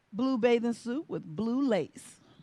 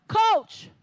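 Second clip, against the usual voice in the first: judge yelling; 8 semitones higher than usual. Retold or imitated. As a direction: call out to the coach. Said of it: "He yells out".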